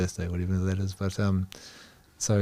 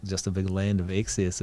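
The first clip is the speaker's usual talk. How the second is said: ordinary delivery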